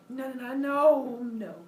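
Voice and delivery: high, drawn-out noes; hammed-up protest; mock refusal. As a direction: stretch the noes out, starting loud. Prometheus refuses, seeing his future.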